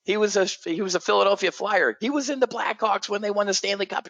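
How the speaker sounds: very high-pitched imitation of an excited fan; breathlessly impressed, insistent mock freak-out. Wife explaining who the hockey star is, freaking out. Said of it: "And she's freaking out".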